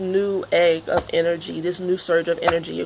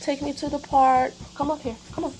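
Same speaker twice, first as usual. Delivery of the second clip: higher pleading pet voice; voicing the dog; 9 semitones higher, 3 dB quieter than usual. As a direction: speak for the dog, begging for the park. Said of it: "He's like".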